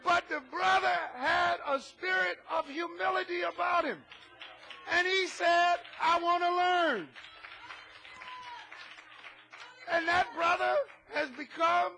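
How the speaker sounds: loud, fervent preaching. Acted. Pastor preaching to his congregation, loud and fervent. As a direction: preach it out hard to the pews.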